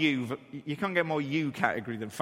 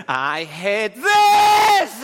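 put-on higher, louder, slow upset child voice; 7 semitones higher, 11 dB louder, much slower than usual. Frightened son telling dad he hates it, wailing. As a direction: wail it like a scared kid.

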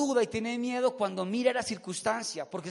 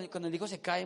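normal talking voice

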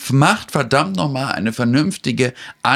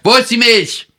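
loud, high, blunt question; rude blurted demand; 10 semitones higher, 6 dB louder than usual. Pretend. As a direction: bark it at the shop assistant.

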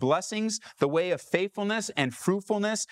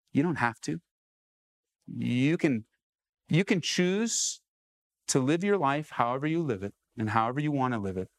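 normal talking voice

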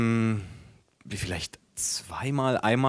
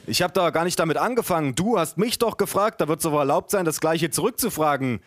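high, quick indignant protest; defensive bickering; 6 semitones higher than usual. Acted. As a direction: argue back in a raised pitch. Acted defensive bickering in story.

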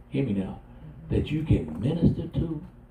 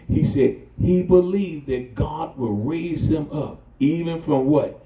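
preaching build; 4 semitones higher, 6 dB louder than usual. Real, not acted. Preacher builds to full force.

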